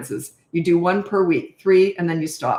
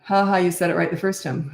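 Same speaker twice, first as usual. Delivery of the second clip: ordinary delivery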